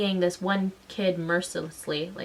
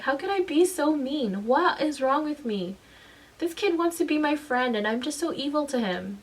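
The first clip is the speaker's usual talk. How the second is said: retold distress; 7 semitones higher than usual. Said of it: "I s-, cried so hard".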